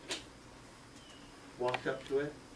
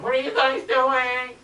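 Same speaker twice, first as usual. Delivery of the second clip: higher, louder, slow call from afar; 10 semitones higher, 7 dB louder, slower than usual. Retold or imitated. Debbie calling from the trail to the kids, wondering. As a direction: call it across the woods.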